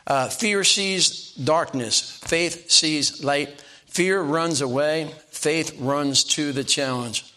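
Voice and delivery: drawn-out, deliberate pulpit delivery; measured sermon rhythm, savoring each line; slow preaching cadence. Acted. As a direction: lay each contrast down slowly, word by word. Preaching fear versus faith contrasts.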